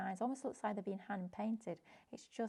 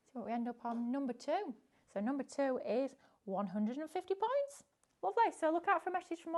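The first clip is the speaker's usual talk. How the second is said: hyped giveaway announcement; 3 semitones higher, 4 dB louder than usual. Acted. Announcing the prize draw winner.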